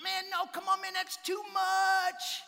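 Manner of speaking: high, loud, drawn out protest; touched protest. Retold or imitated. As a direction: protest that it is too much, high and slow. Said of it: "He goes".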